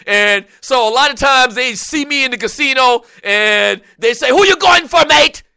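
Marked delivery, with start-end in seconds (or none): 4.3-5.4 rowdy mock Aussie-Irish accent, higher and louder; attempted Australian accent. Irish fight fan challenging him in the casino, botched Australian accent. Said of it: "that's an Australian accent".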